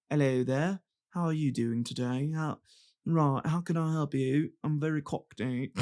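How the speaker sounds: badly faked cockney greeting; cockney accent. Shown as a demonstration. Podcaster trying out the worst accent to do, badly faked cockney. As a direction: have a go at a hammy cockney. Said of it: "is the cockney one".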